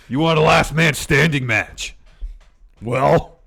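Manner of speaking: slow, loud, high imitation; hammed-up wrestler shout; wrestler challenge. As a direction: bellow it as a mock threat. Acting Braun's shouted challenge.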